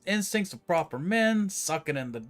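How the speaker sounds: hammed-up New York-British tough character; half New York, half British. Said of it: "half British thing going on".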